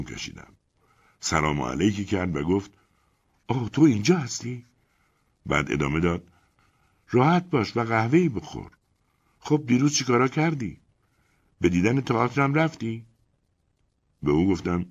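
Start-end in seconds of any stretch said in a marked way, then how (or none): 3.5-4.6 lighter, higher woman's voice; wife greeting the narrator absently; Elena's voice. Acted. 7.1-13.1 higher woman's voice, breezy; Elena, casual. Acted. Elena offers coffee.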